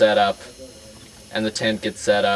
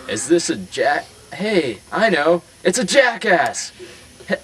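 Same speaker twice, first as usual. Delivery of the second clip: high, loud taunting clown voice; mocking clown; 8 semitones higher, 3 dB louder than usual. Old clown mocking Jimmy before the crowd, high jeering voice. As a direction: rile up the crowd against him.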